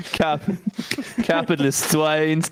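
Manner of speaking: mock Russian accent. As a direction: draw it out in a cartoon Russian accent. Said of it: "And specifically Russian ones".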